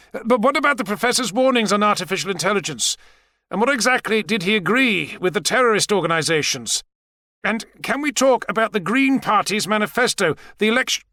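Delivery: high, insistent questioning; pressing TV interviewer. Acted. An interviewer pressing a politician on television, insistent.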